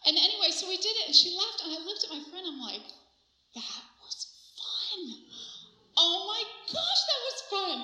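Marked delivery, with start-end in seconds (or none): 3.5-5.3 hushed, savored delight to a friend; thrilled whisper. Acted. Thrilled aside to friend, acted. 6.0-7.8 high, drawn-out, delighted exclamation; thrilled outburst. Acted. Thrilled outburst to friend, acted.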